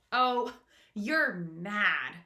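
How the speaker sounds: slow, drawn-out cry of disbelief; earthworm cries out. Acted. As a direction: stretch it out in dismay. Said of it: "cried the earthworm".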